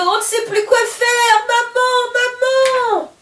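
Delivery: very high, loud panicked call; boy in the bath calling his mother in panic; child calling mum. Acted. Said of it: "s'écrie Polo".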